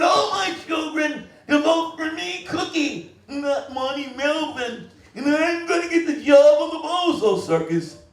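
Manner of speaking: a clown bragging about stealing the job in a Big Bird voice. Retold or imitated. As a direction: play Cookie the Clown sounding like Big Bird. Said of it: "Cookie the Clown spoke like Big Bird".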